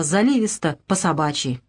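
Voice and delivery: usual speaking voice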